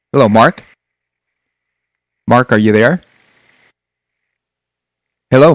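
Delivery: loud calling down a dead line; shouting into a phone. Real, not acted. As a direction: raise your voice, trying to reach the caller. Host calls out to silent caller.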